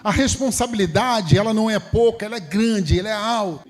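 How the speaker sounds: high-pitched, emphatic sermon delivery; impassioned preaching. Real, not acted. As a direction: preach it high, drawing out the key words. Preaches with raised voice.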